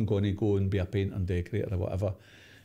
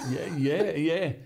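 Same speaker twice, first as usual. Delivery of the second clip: eager yes; 8 semitones higher, much slower than usual. Retold or imitated. Eager boy agrees to manager.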